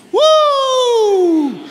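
a high, loud, long whoop of joy; joyful whoop. Real, not acted. Preacher whooping to the church with joy. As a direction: let out a big celebratory woo.

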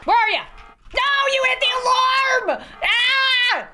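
high, loud yelling; frantic high-pitched outburst; alarmed shout. Pretend. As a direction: shout in dismay, ending in a scream. Alarm tripped in game.

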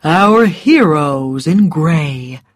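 slow, mocking drawl; scornful heroes in gray; sneering. A narrator's reading. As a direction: say it like an insult. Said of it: "made it sound like an insult".

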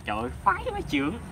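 high, fast, thrilled outburst; delighted. Real, not acted. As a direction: gush with delight. Delighted exclamation.

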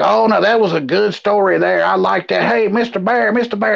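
put-on a chatty old lady yelling for Mister Bear; old woman in the audience. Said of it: "dress me up as an old woman".

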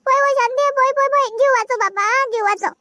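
high, panicked begging to run; terrified pleading. Acted. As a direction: cry for God to save her. Fleeing ghost, pleading. (घाबरून देवाचा धावा)

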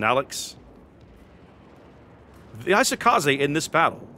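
usual speaking voice

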